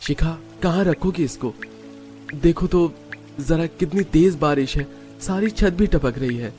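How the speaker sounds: worried husband. Acted. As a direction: fret mildly about the leaking roof. Husband worries about rain. (हल्के से चिंतित पति)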